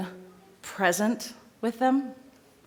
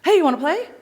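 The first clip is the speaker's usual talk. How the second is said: imitating a dog's quick correction; 8 semitones higher, 6 dB louder than usual. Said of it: "It's like".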